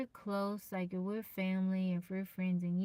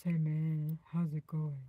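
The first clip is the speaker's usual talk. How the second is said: low, put-on deep voice; 3 semitones lower, slower than usual. Shown as a demonstration. Streamer playing deep-voiced character for viewers, lowered voice. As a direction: drop down low, playfully. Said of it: "in your deep voice".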